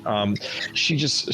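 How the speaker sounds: ordinary talk, nothing marked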